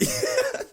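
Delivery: sudden very high laugh; high squealing laugh. Real, not acted. Laughing at his own fake-girl impression, squeaky.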